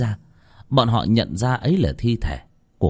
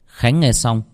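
ordinary delivery, nothing marked

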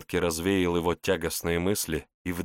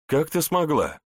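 startled, raised question; surprised; 9 semitones higher, 3 dB louder than usual. Acted. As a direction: ask in sudden surprise. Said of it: "удивился Ичиро".

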